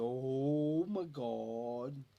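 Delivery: drawn-out, awestruck "oh" in his drawl; slow talker imitation. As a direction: mimic a slow talker's amazement. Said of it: "That's how he talked".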